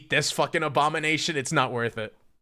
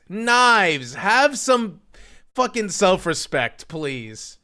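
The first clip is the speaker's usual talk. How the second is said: louder and higher, pleading in disbelief; exasperated call-out; 4 semitones higher, 5 dB louder, much slower than usual. Real, not acted. Streamer loudly begging friend Knives to have standards. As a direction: call her name out like you can't believe her.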